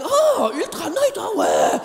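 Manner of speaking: high mock French wife, slow and drawn-out. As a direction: parody her fussing over pads. Mocks her checking pads on toilet.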